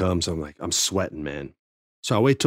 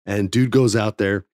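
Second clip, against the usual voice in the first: ordinary delivery, nothing marked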